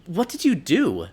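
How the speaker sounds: high, loud amazed question; astonished. Acted. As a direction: blurt it out in awe. Stunned question to the man.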